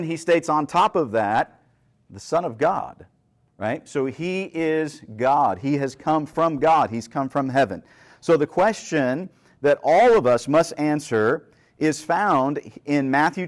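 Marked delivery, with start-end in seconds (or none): none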